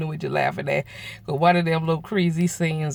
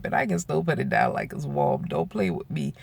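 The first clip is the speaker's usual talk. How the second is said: sassy low comeback; 10 semitones lower, 3 dB quieter than usual. Retold or imitated. Boasting to her friend, sly and low. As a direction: sly, lower and softer boast.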